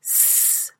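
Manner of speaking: soft, thin "sss" hiss; voiceless sibilant snake noise; plain snake hiss. Acted. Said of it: "the plain snake only hisses".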